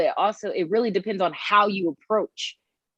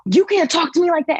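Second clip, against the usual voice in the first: raised, louder mock threat; imitating his angry retort; 10 semitones higher, 5 dB louder than usual. Man at gas station answering her refusal, angry retort. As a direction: snap it back like an offended man. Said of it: "he was just like".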